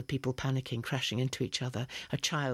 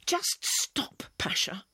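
a much higher, whispered spit of anger; furious; much slower than usual. Acted. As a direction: spit it back at him. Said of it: "I spat back at him, furious".

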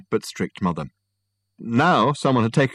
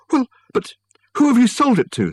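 very high, loud dismay; spluttering; 7 dB louder than usual. A narrator's reading. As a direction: splutter it in shocked protest. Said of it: "spluttered Michael".

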